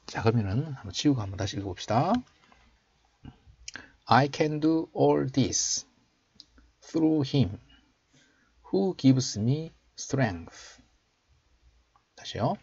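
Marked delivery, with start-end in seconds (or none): 4.1-10.8 slow, raised teaching voice; slow model reading. Shown as a demonstration. Teacher showing learners the English verse, slow model reading. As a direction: read it out slowly for learners to copy.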